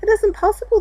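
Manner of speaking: high, loud cry of disbelief; frightened cry. A narrator's reading. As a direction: cry it out in fear on a storm-tossed boat. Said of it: "they cried".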